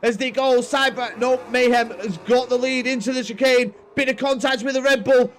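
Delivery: loud, high play-by-play finish; excited race commentary. Acted. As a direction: call the race home at full pitch.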